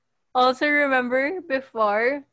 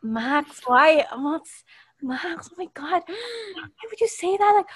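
higher shocked mock classmates; classmates gasping; 6 semitones higher than usual. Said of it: "everyone was like".

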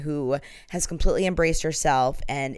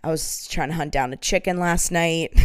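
ordinary talk, nothing marked

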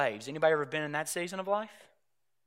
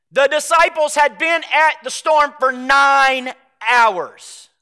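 louder, slow, drawn-out sermon emphasis; emphatic preaching; 6 semitones higher, 10 dB louder, slower than usual. Acted. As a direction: lean hard on every word of the storm. Preaching builds on nine-hour storm.

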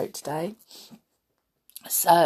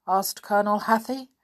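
ordinary delivery, nothing marked

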